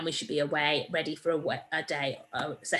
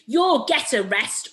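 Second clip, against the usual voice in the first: louder, higher slow gloat; shouted; 6 semitones higher, 7 dB louder, slower than usual. A narrator's reading. Mister Dart bursts in on the thieves, a loud gloating shout. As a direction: call it out as you burst in. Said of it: "shouted Mister Dart".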